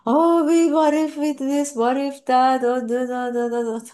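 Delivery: chattering mind. Acted. Mind butting in with pointless what-ifs after a death, imitated chatter. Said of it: "for the mind to come and say".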